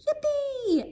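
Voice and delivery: squealed high with joy; a little frog's delighted cheer; excited yippee. A narrator's reading. Froggy cheers about his first Christmas, high and excited.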